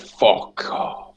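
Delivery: voiceless, whispered retort of amazement; hushed disbelief. Retold or imitated. Friend reacting to the psychic's vision, hushed disbelief. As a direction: whisper it, imitating a stunned friend.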